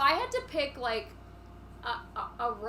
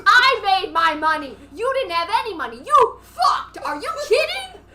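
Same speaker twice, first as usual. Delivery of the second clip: very loud, higher mock fury with a laugh; acted furious shout; 6 semitones higher, 11 dB louder than usual. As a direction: scream down your rival. Acted quarrel, Ramona screaming.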